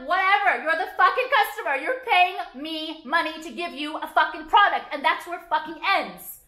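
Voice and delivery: high, loud scornful rant; scornful telling-off. Retold or imitated. Imagining telling off the worker.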